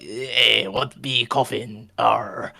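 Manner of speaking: slow mock pirate; drawn-out puzzled pirate question; weird pirate voice. Shopkeeper answers Tyler's warning, hammy pirate voice. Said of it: "he's gonna do weird voices".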